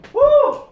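high, loud whoop of pain. Real, not acted. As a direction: let out a burning whoop. Hot wing burn.

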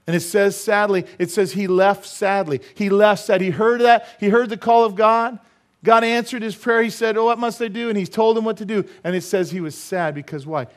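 high, loud, driving preacher; full-force preaching. Acted. Preacher lamenting the man who left sadly, full-force preaching. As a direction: hammer it out, pitch held high.

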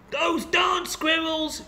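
put-on high, slow, cranky old man shout; old man yelling. A narrator's reading. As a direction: bellow it like a fed-up old man. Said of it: "yelled Old Man Footwire".

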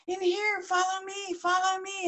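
high, coaxing sing-song; luring fox. Acted. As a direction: beckon them sweetly into the trap.

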